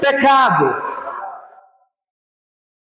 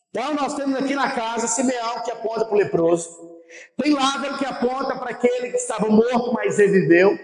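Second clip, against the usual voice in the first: usual speaking voice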